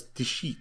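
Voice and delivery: "Dixit" said dih-SHEET; imitated mispronunciation. Co-host quoting host's old version, "Dixit" as dih-SHEET. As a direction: say "Dixit" with sh and long ee. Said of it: "pronouncing it as".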